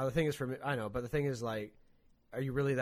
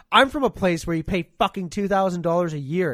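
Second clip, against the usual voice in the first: excited outburst; 7 semitones higher, 14 dB louder than usual. Retold or imitated. Telling clerk about home prices.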